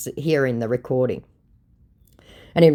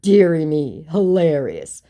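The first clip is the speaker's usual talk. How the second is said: usual speaking voice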